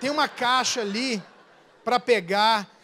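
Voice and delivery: soft, coaxing endearments; butter him up gently, voice dropped; sweet-talking. Shown as a demonstration. Wife coaxing husband to fetch a box, soft sweet talk. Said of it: "você tem que aprender a falar".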